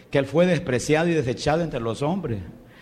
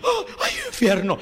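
high, frightened wailing over fear of hell; mock a scared believer crying out about hell; 11 semitones higher than usual. Preacher mocking a frightened churchgoer, high scared wail. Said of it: "si usted está en esa silla".

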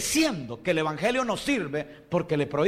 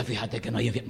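much lower, softer sly voice of the visitor; sly tempter; 10 semitones lower, 5 dB quieter, much faster than usual. Retold or imitated. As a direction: coax them like a smooth doorstep preacher. Voicing the Witness again.

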